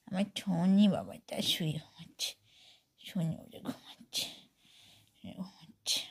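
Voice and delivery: whispering. Real, not acted. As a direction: whisper so it stays asleep. Whispering over sleeping pet.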